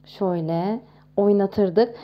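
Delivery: ordinary talk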